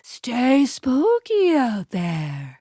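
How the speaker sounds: slow, drawn-out spooky voice; eerie farewell, words drawn long; spooky send-off. Acted. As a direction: stretch it out like a playful haunting. Spooky sign-off in game.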